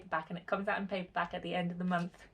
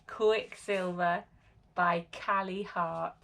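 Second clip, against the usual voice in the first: usual speaking voice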